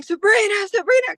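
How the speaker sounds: high, loud frantic yelling of a name; retold panicked scream of Sabrina; screaming her name. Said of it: "and I'm screaming".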